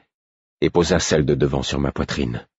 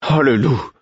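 dismayed cry; 7 semitones higher than usual. A narrator's reading. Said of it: "s'écria-t-elle".

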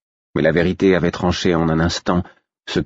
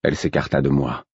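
usual speaking voice

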